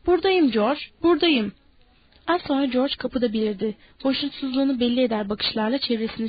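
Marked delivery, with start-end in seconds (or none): 0.1-1.5 higher eager answering call; calling back. A narrator's reading. Lenny answering George from the room, calling back. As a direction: call back to someone outside. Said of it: "Hemen cevap verdi".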